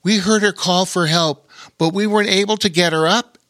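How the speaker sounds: put-on hurried kid; young boy voice. A narrator's reading. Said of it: "added Lore".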